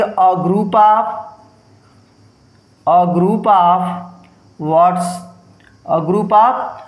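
extremely slow, word by word; slow dictation. Shown as a demonstration. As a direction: dictate so they can write. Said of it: "आप यहाँ लिख देंगे". (बहुत धीमे लिखवाना)

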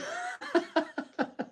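airy giggle; short breathy laugh; high breathy laugh. Real, not acted. Laughs at own joke.

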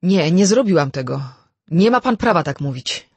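loud, indignant outburst; vehement denial. A narrator's reading. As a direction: push the accusation away hard. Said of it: "Vera odparła gwałtownie".

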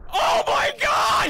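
high, drawn-out shriek; real shocked outcry; shocked cry. Real, not acted. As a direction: gasp it out in disbelief. New monster added, shock.

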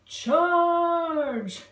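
high, loud battle cry; yelled. Acted. Soldier beetles charging the spiders, battle yell. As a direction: lead the charge. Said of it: "yelled a squadron".

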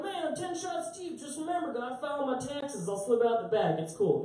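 high-pitched hammed-up drunk buddy; mock drunk bar-goer. Drunk man excusing himself to friends at the bar, mock high-pitched voice. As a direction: play an excitable drunk way up high.

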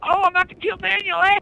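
high-pitched, excited gamer crowing; excited gloating. Real, not acted. Player crowing over kills in game.

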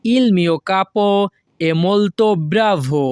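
slow, careful Italian model reading; teacher pacing it out; slow model sentence. Shown as a demonstration. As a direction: spell it out slowly for the class. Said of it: "এর Italian ভাষা হবে".

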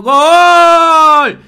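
very loud, high raw "gol"; natural-voice goal shout. Shown as a demonstration. Showing an unprojected natural voice, loud goal shout. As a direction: yell goal in your own unplaced voice. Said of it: "la voz natural es esta".

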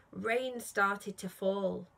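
ordinary delivery

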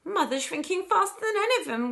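high, loud distressed wail. A narrator's reading. Daughter watching her mother shrink, wailing. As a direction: wail it out in acted dismay. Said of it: "wailed Missus Bucket".

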